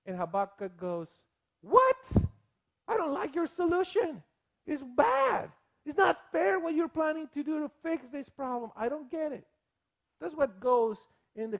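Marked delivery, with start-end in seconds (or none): 1.6-2.1 sharp, high, loud what; a startled outburst of disbelief; shocked what. Retold or imitated. Habakkuk hearing God's plan, shocked high what. 2.9-9.5 raised, protesting objection; indignant prophet. Retold or imitated. Habakkuk to God, raised indignant protest.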